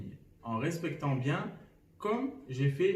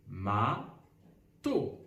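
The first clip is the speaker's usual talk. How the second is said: Ma-to with a long pause between ma and to; reversed syllables, separated; 5 semitones lower than usual. Shown as a demonstration. Teacher shows inverted syllables, "Ma-to" slowly split. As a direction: draw out "Ma", pause, then a separate "to". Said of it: "mettre la deuxième syllabe en premier".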